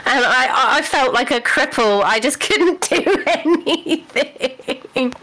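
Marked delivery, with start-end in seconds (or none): 2.0-5.1 laughing. Real, not acted. Laughs while telling.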